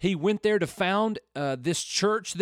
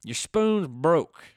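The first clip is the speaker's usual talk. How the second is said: put-on slow, stretched Southern accent; playful Southern twang, very slow; 4 dB louder, much slower than usual. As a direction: draw it out in a thick Southern accent. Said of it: "my best Southern accent".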